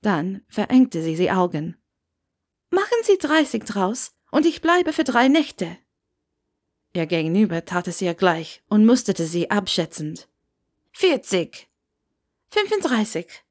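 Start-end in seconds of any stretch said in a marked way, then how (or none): 2.7-5.8 higher, firm Hermione haggling; pushing the price down, raised young voice; young Hermione voice, bargaining. A narrator's reading. Hermione haggles with the old innkeeper, higher and firm. 10.9-13.4 put-on Scottish old man. A narrator's reading. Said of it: "den schottischen Akzent hören".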